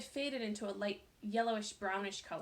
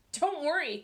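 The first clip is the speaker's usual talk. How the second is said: louder, angry outburst; exploding; 6 semitones higher, 10 dB louder than usual. A narrator's reading. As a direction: burst out in anger. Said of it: "Colleen exploded".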